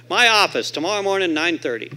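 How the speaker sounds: loud, high imitated command, easing off; priest giving orders. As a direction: announce the appointment as the priest. Priest orders a meeting by phone.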